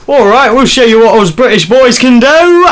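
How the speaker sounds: hammed-up Cockney accent; exaggerated Cockney, played for laughs. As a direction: put on a mock Cockney British-lad voice. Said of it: "Can you do Cockney?".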